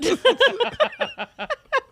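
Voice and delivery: shrieking burst of laughter; a high, loud cackle of delight. Real, not acted. Laughs at flight remark.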